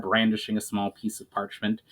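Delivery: usual speaking voice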